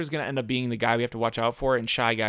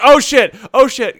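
startled shout; 12 semitones higher, 13 dB louder, slower than usual. Real, not acted. Racing close call.

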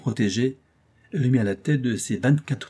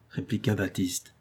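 plain reading voice

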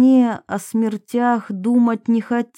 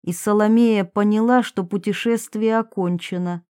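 usual speaking voice

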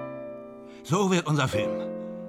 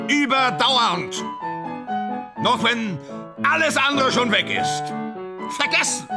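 exalted declaiming; 7 semitones higher than usual. Acted. Grandiose speech over music.

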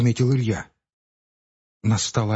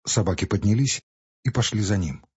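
plain reading voice

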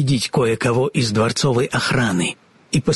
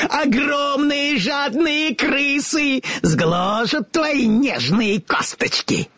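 high, slow, relishing witch voice; gloating witch; 14 semitones higher, slower than usual. A narrator's reading. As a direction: savour each threat, wickedly. Witch threatens the girl.

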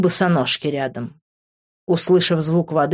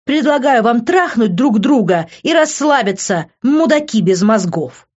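higher and louder, contemptuous; scornful insult; 6 semitones higher, 4 dB louder than usual. A narrator's reading. Liv insults both men before storming off. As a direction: fling the insult and go.

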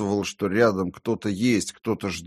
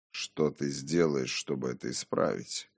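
hushed, lowered voice of conscience; calm inner voice; 5 semitones lower, 7 dB quieter than usual. A narrator's reading. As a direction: challenge him quietly, like his conscience. The inner voice questions him calmly.

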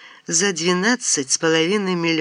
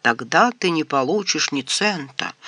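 plain reading voice

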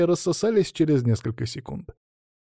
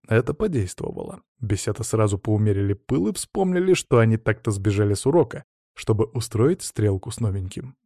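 usual speaking voice

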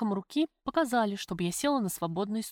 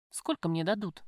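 ordinary delivery, nothing marked

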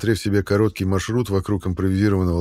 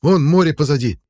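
loud high panting yell; breathless shout; 7 semitones higher, 6 dB louder than usual. A narrator's reading. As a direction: gasp it out at full voice. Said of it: "задыхаясь, выкрикнул Боков".

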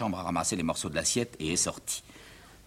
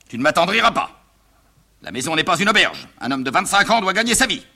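stern indignant father; 10 semitones higher, 10 dB louder than usual. Acted.

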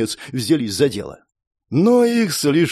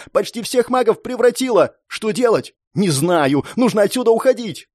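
urgent, high-pitched acted cry; yelling over din; 8 semitones higher than usual. A narrator's reading. Girl tells ally to flee the monsters, urgent high yelling. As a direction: report the disaster in a pressed, raised pitch. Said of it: "кричит она сквозь вопли и грохот".

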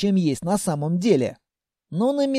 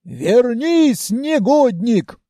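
raised, drawn-out scolding holler; scolding call; 4 semitones higher, 4 dB louder, much slower than usual. Acted. Grandmother calls the climbing runaways back, scolding holler. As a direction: call a rascal back from afar.

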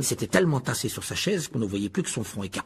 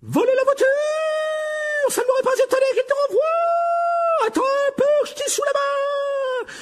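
furious screaming mother. A narrator's reading. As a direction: let the howler blast the whole hall. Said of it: "Les hurlements de madame Weasley".

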